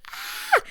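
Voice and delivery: a very high quick yelp of alarm; sudden high startled cry; shrill mock shriek. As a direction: shriek like the screen just went blurry. Seeing blurry phone.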